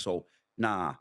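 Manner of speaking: ordinary delivery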